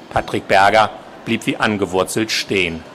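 ordinary delivery, nothing marked

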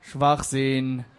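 low, curt dismissal; Facebook commenter. Acted. Facebook comment replies to the conspiracy theory, dismissive. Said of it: "Der Facebook-Kommentar entgegnete".

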